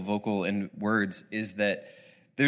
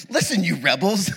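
higher, louder hammed-up yell at the rebels; mock angry Moses; 8 semitones higher, 5 dB louder than usual. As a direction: ham up Moses losing his temper. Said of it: "he shouted".

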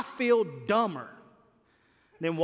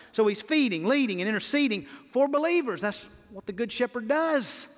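ordinary delivery

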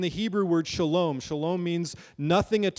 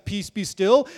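calm command; 4 semitones higher, 6 dB louder than usual. Retold or imitated. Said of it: "he talks to the storm".

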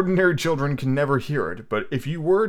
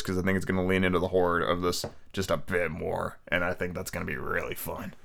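ordinary delivery, nothing marked

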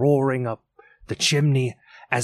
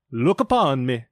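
echoing ethereal energy being. A narrator's reading. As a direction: proclaim it like a towering jolly ghost. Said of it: "said the spirit".

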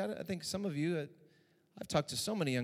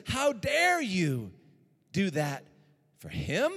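very slow, higher, outraged; indignant son; 5 semitones higher, 5 dB louder, much slower than usual. Acted. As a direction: drag out the how dare you. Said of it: "He's angry".

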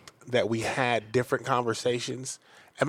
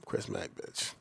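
much lower, softer seductive growl; Chris Mack voice; 10 semitones lower, 12 dB quieter than usual.

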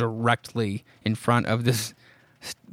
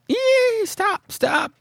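a very high shriek that breaks into a higher stop, stop; mock scream; much slower than usual. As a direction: scream as the horse turns on you. Farmer boy attacked by horse.

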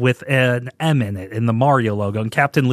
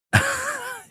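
sudden high-pitched burst of laughter; a helpless squeal of laughter; 5 dB quieter than usual. Real, not acted. Host cracking up at the drug ad, squealing laugh.

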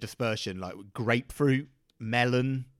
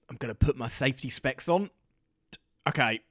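ordinary talk

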